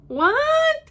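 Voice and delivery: very high, loud "what?"; shocked disbelief. Retold or imitated. Reacting to her date's car remark, shocked disbelief. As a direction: squeal it in mock shock. Reenacts disbelief at his confession.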